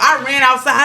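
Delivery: usual speaking voice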